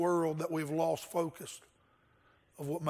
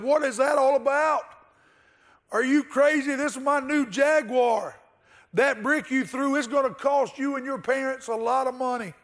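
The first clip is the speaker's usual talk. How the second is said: put-on higher and louder, yelling at the kid; livid shouting; 7 semitones higher, 4 dB louder than usual. A narrator's reading. Angry executive yelling at the kid over his Jaguar. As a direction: bark furious at the boy who dented the car. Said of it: "He was livid".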